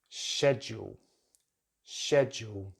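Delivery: "schedule" as SHED-yool; "schedule" starting with sh, first syllable stressed; British pronunciation. Shown as a demonstration. Teacher models British English, "schedule" as SHED-yool. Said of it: "correct British English pronunciation is".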